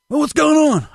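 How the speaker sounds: high put-on boss voice; Herb. Boss answers a mechanic's call for help, high impression. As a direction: imitate the boss in a high, strained voice. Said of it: "So he's like".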